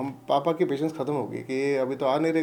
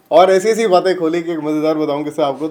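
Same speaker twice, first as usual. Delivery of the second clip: normal talking voice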